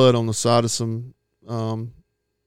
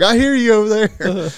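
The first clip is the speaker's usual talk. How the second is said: lightly voiced friend, pitched up high; a teasing, higher take on his hunting buddy; amused buddy voice; 10 semitones higher, 3 dB louder than usual. Buddy on the phone hearing the fake crying, teasing and high. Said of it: "He's like".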